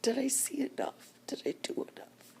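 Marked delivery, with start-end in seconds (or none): none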